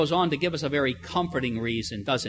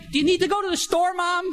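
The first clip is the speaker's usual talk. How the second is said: put-on eager teen; 10 semitones higher than usual. Teen offering to drive Mom across the street, mock-eager. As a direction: chirp it like a kid begging for the car keys.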